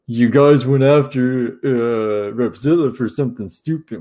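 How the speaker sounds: put-on voice of complaining critics; mocking put-on complaint; mock critics' voice. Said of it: "people are gonna be like".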